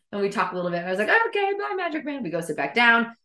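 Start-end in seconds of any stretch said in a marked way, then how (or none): 1.3-2.2 high singsong farewell; acted-out high social voice; awkward goodbye. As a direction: chirp a put-on casual goodbye.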